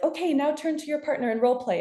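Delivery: high, quick chipper workshop leader; chirpy trainer. Retold or imitated. Work trainer telling the room to pair up, high and chipper. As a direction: play the upbeat trainer giving instructions. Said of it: "they're like".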